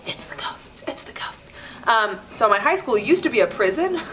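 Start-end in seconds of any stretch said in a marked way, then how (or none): 0.1-1.4 spooky whisper. Retold or imitated. Classmates telling each other it is ghosts, whispered. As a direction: whisper it low and eerie.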